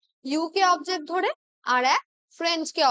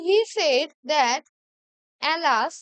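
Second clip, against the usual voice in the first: slow dictation; much slower than usual. Shown as a demonstration. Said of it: "মিনিংটাই change করে দিচ্ছে".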